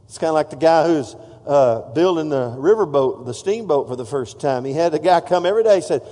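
usual speaking voice

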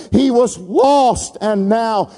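drawn out, deliberate; father savoring the return; slow emphatic. Acted. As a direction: let each word land slowly. Father's words, slowed for emphasis.

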